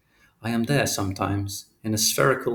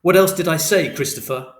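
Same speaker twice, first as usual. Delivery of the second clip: louder, higher insistent shout; repeated angry demand; 7 semitones higher, 7 dB louder than usual. A narrator's reading. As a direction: push harder, yelling the question. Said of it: "Father repeated the question".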